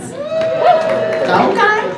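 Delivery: Long Island accent squawk. Shown as a demonstration. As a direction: belt out "Okay" in a brash Long Island accent. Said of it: "I used to talk like this".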